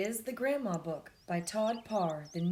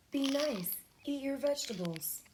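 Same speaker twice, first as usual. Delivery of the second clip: higher, fussing grandma voice; grandma advice; 8 semitones higher than usual. Acted. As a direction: nag gently like a caring grandma.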